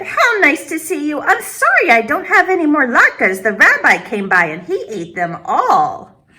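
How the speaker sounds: put-on high, bright old grandmother voice; old woman greeting a visitor at her door; Baba Brina greeting. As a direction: welcome the guest like a kind old granny.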